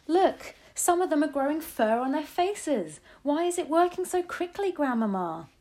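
put-on high-pitched, astonished boy; excited child. A narrator's reading. Boy questions grandmother about the witches changing, excited. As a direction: point it out eagerly, pitched high.

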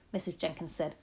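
ordinary delivery

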